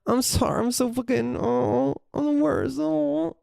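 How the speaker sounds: high, whiny self-pitying moan; mock shameful whining. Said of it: "Shame is like".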